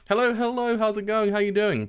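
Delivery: high, singsong welcome; cheery greeting. Real, not acted. Streamer welcoming viewers mid-reading, cheery. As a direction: greet newcomers high and lively.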